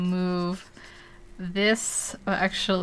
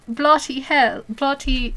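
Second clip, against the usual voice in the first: louder, higher stab at British; British accent attempt; 6 semitones higher, 5 dB louder, slower than usual. Shown as a demonstration. Streamer testing the word bloody, failed British accent. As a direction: try a rough British "bloody hell". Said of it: "I can't even do the British one now".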